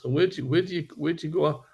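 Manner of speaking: ordinary talk, nothing marked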